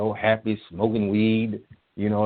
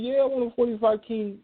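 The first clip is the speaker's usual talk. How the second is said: very high-pitched imitation; high-voiced retold answer; put-on reply voice. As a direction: mimic an eager yes, way up high.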